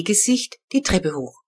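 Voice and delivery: plain reading voice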